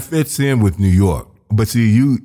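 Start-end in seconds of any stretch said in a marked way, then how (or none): none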